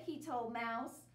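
usual speaking voice

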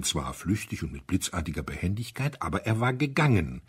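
plain reading voice

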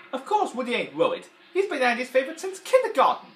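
high, loud grumpy toy voice; Mister Potato Head voice. Retold or imitated. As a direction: sneer it like a jealous toy. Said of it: "there he goes like this".

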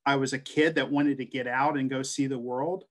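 ordinary delivery

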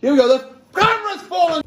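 high, loud outburst; alarmed shout. Real, not acted. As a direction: yell out as the camera topples. Startled as the camera falls over.

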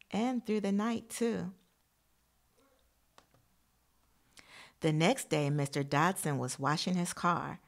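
put-on storybook voice for young listeners; reading to children. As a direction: read the picture book out to the class. Reads picture book to class.

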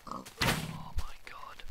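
breathless, slow whispered gasp; whispered after a scare; shaky whisper. Real, not acted. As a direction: gasp out the words after a fright. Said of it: "that was scary".

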